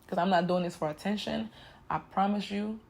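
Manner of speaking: ordinary talk, nothing marked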